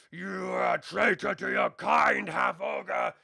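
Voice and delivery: bellowing ogre accusation; slow, loud furious monster shout; angry ogre. Acted. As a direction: roar it at the traitor. Ogre roars at player mid-combat.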